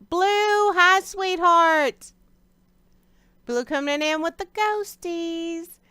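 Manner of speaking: high, slow sweet voice. Real, not acted. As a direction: coo at a pet. Greeting her pet on stream.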